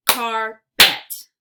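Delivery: Carpet as loud "car... pet"; split into syllables. Shown as a demonstration. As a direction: punch out both halves of Carpet separately. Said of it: "give you two parts to a word".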